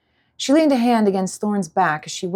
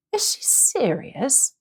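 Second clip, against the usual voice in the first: incredulous; 6 semitones higher, 3 dB quieter, slower than usual. Acted. Dragon asks, incredulous.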